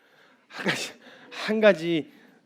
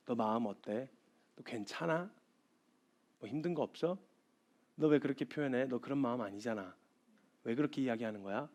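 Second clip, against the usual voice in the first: tender concern; 3 semitones lower, 9 dB quieter than usual. Retold or imitated. Someone gently probing my heart.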